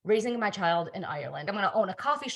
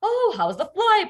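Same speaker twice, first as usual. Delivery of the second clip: husband asking; 11 semitones higher, 5 dB louder than usual. Retold or imitated. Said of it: "he was like".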